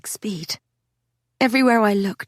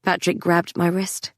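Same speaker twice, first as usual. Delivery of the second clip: usual speaking voice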